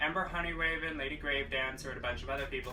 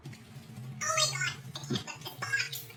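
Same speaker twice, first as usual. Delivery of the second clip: very high breathy squeal of delight; excited squeal. Real, not acted. Vlogger squealing at her new box, very high and excited. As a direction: squeal it like you can't contain yourself.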